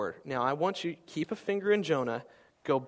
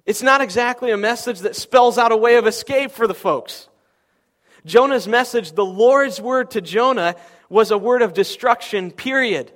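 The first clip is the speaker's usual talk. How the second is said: raised, pressing sermon voice; full-force preaching; 8 semitones higher, 12 dB louder than usual. Acted. Pastor driving the point home to his congregation, full-force preaching. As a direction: preach it hard to the room.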